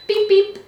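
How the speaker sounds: beeping noise. Pretend. Imitating a kitchen timer beep.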